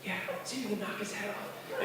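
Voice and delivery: hushed whispered dare; quiet mischievous whisper; whispered scheme. Retold or imitated. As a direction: whisper the plan. Teens plotting in whispers.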